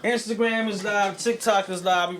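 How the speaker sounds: talking around gum; words through a wad of gum; chewing gum. Real, not acted. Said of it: "Let me get this gum out my mouth".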